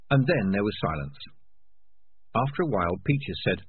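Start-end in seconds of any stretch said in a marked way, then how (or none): none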